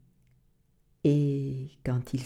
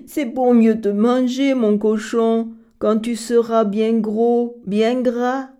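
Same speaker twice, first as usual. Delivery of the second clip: higher and louder, gleeful menace; teasing ogre threat; 5 semitones higher, 5 dB louder than usual. Acted. Arturo teasing the pig about being eaten, gleeful menace. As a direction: tease like a hungry ogre.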